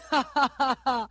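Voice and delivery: staccato derisive ha-ha-ha; mocking laugh. Laughing back at an insult in a quarrel, mocking ha-ha-ha. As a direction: laugh scornfully in even bursts.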